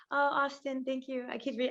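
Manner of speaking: normal talking voice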